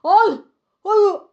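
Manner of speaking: high, loud and flustered; acted alarm, voice jumping up; panicked protest. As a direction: stammer out a shocked denial. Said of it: "얼굴이 사색이 된 거죠".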